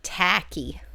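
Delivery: putting on an American accent. Shown as a demonstration. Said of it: "say that in an American accent".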